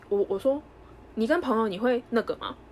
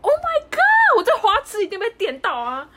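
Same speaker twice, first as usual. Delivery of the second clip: squealed much higher and louder, giddy and star-struck; giddy swooning; 13 semitones higher, 10 dB louder, slower than usual. Retold or imitated. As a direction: relive the moment she fell for him, gushing. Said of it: "然后我就觉得". (花痴般尖声兴奋地喊)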